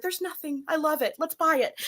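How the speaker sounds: high, eager retold enthusiasm; delighted. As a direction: say it brightly, sold on the spot. Retold delight at clean new house.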